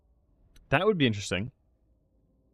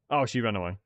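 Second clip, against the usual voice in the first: normal talking voice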